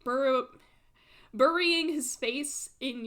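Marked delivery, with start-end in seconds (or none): none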